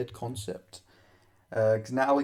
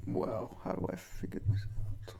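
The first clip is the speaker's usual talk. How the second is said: laughing; 5 semitones lower, 5 dB quieter than usual. Real, not acted. Breaks down laughing.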